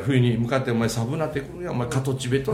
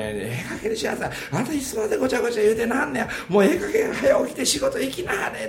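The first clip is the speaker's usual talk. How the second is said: high-pitched, rapid mock nagging; scolding wife; 9 semitones higher than usual. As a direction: imitate a wife nagging her husband out of bed. Husband voices his scolding wife.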